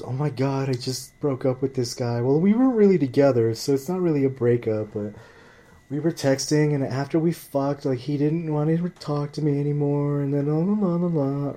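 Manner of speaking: high, whiny valley-girl mimicry; mock her in a high girly voice; sarcastic high-pitched imitation of a complaining girl. Mocking the girl in the car.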